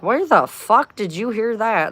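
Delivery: high, baffled outburst; incredulous. Retold or imitated. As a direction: ask it in disbelief. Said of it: "I'm like".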